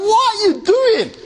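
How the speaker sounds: high-pitched, incredulous scolding; acted protest. Listener objecting to the spoiled rhyme, high incredulous protest. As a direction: object as if the song has been ruined. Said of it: "Like, you're like".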